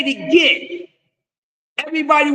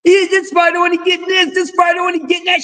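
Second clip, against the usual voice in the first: mocking whiny fans; 5 semitones higher, faster than usual. Mocking fans' complaints.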